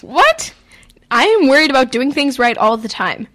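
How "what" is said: sudden high-pitched disbelief; shocked what. Real, not acted. Host reacting in disbelief to her sister's answer, shocked and high.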